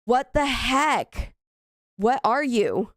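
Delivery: slow, drawn-out bafflement; baffled. Real, not acted. Gamer to a mystery figure on screen, baffled. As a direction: stretch it out in disbelief.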